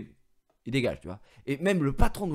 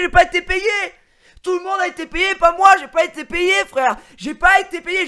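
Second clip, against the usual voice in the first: much higher and louder, indignant yelling; outraged shout; 13 dB louder than usual. Retold or imitated. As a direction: shout the injustice at everyone. Retold outrage at not being paid. (cri indigné, beaucoup plus fort et aigu)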